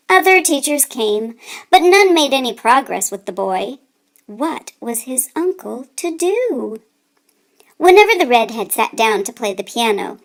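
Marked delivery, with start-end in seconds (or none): 4.3-6.8 whispered, slow wondering; hushed question. A narrator's reading. Narrator wondering aloud to listeners, whispered. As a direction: drop to a whisper as if sharing a worry.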